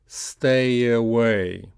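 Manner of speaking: slow "Stay away" joined by a y glide; "Stay away" as one slow, unbroken word; linked practice model. Shown as a demonstration. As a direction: stretch "Stay away" into stay-yaway. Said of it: "So let's practice that".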